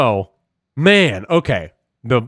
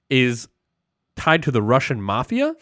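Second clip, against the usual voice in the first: ordinary talk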